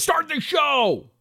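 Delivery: high, slow mock demand to start; hammed-up viewer shouting for the show to begin. Host reading Adam's comment aloud, mock high shout.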